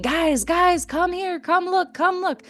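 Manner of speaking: high calling out for help; calling out to his friends in a dream, high and urgent; retold screaming. Dreamer calling his friends to the basement door, high mock yell. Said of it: "And he's screaming".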